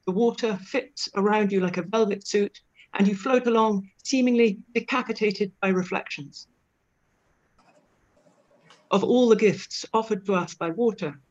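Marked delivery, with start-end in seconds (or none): none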